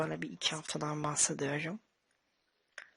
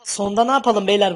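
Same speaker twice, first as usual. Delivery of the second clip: loud aside; 2 semitones higher, 12 dB louder than usual. Real, not acted. Aside to the audience.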